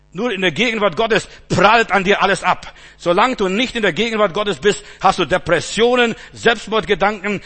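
loud, insistent sermon voice; fervent preaching. Acted. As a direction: hammer the refrain at the congregation.

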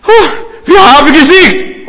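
high, loud, drawn-out yell; acted shout of triumph; victory cry. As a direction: cry it out like an exhausted messenger. Acts the runner's dying victory cry.

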